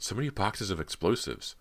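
high-pitched dismay; choked out. A narrator's reading. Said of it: "Cody choked out".

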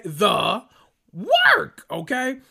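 "The work" leaping way up into a high squeal, rising at the end; squealed emphasis. Real, not acted. Repeating the point, peaking.

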